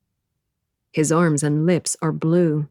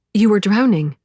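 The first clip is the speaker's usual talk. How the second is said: raised-voice protest; 5 semitones higher, 6 dB louder than usual. A narrator's reading. Rescuer answers the man, incredulous.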